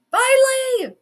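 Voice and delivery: high, loud cry of relief; real, elated high-pitched yell; jubilant shout. Real, not acted. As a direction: shout it out in celebration. Celebrating that Kirk is gone.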